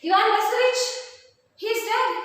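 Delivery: shrill high scream of despair; high loud panicked scream over the fallen suitor. Acted. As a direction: shriek that he is dead. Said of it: "she screams".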